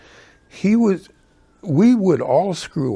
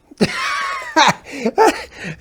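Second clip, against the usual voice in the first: laugh; 4 dB louder than usual. Real, not acted. Laughing at the apology.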